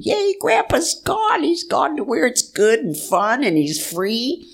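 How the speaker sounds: put-on high, bright little-girl cheer; joyful child. Nine-year-old cheering that Grandpa is free, high childlike glee.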